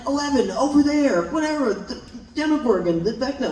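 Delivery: high-pitched, hammed-up scene lines; mock in-character dialogue. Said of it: "just being like".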